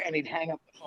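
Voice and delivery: ordinary delivery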